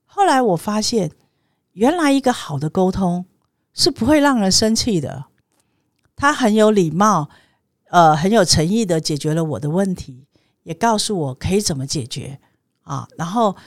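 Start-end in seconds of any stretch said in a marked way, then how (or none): none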